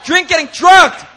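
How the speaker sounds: high, loud, indignant preaching shout; outraged shout. Real, not acted. Preacher to the church, outraged at bystanders, shouting. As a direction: blast the crowd with disbelief at the injustice.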